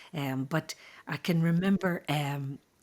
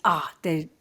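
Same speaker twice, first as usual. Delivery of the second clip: ordinary talk, nothing marked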